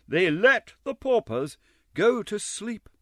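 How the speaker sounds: mock the generosity; ironic aside. A narrator's reading. Narrator sneering at the workhouse laws, sarcastic.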